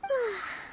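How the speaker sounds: drawn-out tired exhale; exhausted sigh. Acted. Leader alone after the crowd, sighing. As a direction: let the whole day out in a sigh.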